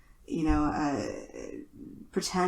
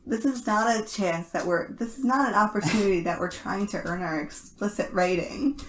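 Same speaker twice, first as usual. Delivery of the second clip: usual speaking voice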